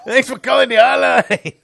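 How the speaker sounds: put-on high, loud Mexican-accent shout; mock Mexican accent. Host greeting a hotline caller, loud mock Mexican accent. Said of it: "English with a Mexican accent".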